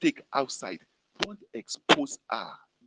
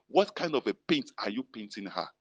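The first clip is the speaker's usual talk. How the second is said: normal talking voice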